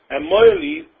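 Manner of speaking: high, loud call of the name; sudden loud plea. Acted. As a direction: call out to him across the room. Rava calls out to Rafram.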